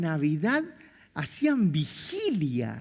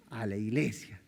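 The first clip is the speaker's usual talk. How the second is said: much lower, softer, curt command; a hint of a firm mother; stern mother; 9 semitones lower, 6 dB quieter than usual. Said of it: "mi mamá decía". (madre firme, mucho más grave y seca)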